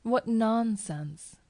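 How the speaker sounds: high, drawn-out scoff; dismissive. A narrator's reading. Cousin waving away the narrator's accusation, dismissive. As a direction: brush it off airily, stretching the words.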